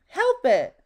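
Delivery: Mister Sneeze's protest. A narrator's reading. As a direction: finish the protest right after a sneeze. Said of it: "replied Mister Sneeze".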